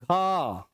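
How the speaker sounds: ख held long on one breath; aspirated ख, slowly pulled out; drawn-out aspirate. Shown as a demonstration. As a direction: stretch the vowel of ख. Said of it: "थोड़ा खींच के बोलते हैं".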